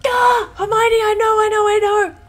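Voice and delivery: high, loud exasperated panic; frantic yell. Real, not acted. Player yells back at the nagging Hermione, frantic. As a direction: shout back in a flustered rush. Companion nags for antidote.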